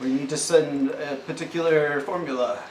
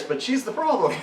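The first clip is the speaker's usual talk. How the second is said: laughing; 10 semitones higher than usual. Real, not acted. Laughs at the absurdity.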